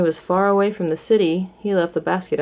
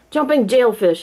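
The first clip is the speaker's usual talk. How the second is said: startled cry; 6 semitones higher, 4 dB louder than usual. A narrator's reading. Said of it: "he cried".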